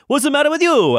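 high, loud hammed-up Italian accent; mock Italian accent. Imagined opponent snapping back in a debate, mock Italian accent. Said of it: "this person I'm talking to is Italian now".

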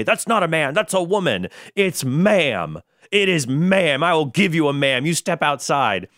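high-pitched mock indignation; imitated angry outrage. Gamer in video angry at being called sir, mock indignant. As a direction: act out an offended correction, pitched well up. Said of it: "who is very angry at being called sir".

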